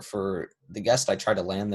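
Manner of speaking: ordinary talk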